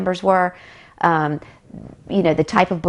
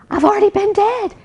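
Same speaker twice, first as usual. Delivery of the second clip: much higher, urgent retold cry; frantic blurt. Injured woman to ambulance lady, a much higher frantic insistence. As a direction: blurt it out, half unhinged. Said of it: "trying not to sound like a raving lunatic".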